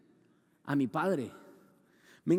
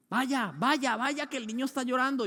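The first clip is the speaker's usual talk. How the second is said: a lightly higher imitation of his wife shooing him off; nagging wife; 8 semitones higher than usual. Preacher imitating his wife sending him to the crying baby, lightly higher. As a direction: hurry the husband off, lightly mocking.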